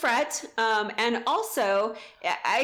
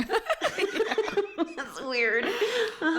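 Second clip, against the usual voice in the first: laughing; 9 semitones higher, much slower than usual. Real, not acted. Laughing at same number one.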